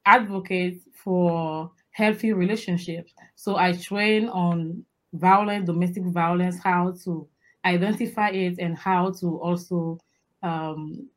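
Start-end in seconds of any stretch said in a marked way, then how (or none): none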